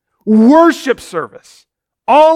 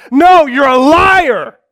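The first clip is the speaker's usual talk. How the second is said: slow accusing shout, louder; angry shout; 3 semitones higher, 5 dB louder, slower than usual. Acted. Pharisees in the crowd shouting at Jesus, angry. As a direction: yell it at him.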